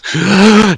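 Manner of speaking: sharp high gasp as he comes back to life; big revival gasp. Acted. GM voices Gundren coming back to life with a big gasp. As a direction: gasp for his first breath again. Revived dwarf's first breath.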